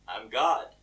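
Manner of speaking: low, understated divine voice; voice of God. Acted. God naming himself to the stranded man, low-voiced. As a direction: state it low and calm. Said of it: "And the voice says".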